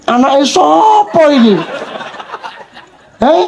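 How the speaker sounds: a hint of a cross child; complaining child. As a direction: play the grumbling child. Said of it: "child complaining about song".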